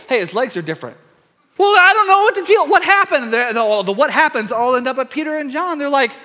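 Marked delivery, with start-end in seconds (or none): 1.6-3.3 high, loud, put-on baffled onlooker; stunned bystander blurting confusion. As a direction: voice a flustered local who can't explain it. Bewildered bystander voiced.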